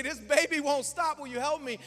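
loud, high frantic plea; desperate plea. Retold or imitated. Tired parent pleading with church grandmamas, loud and frantic. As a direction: beg for help at the end of your rope.

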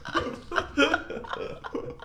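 laughing. Real, not acted. Laughing after the joke.

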